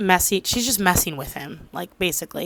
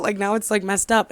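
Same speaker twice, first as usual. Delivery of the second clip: usual speaking voice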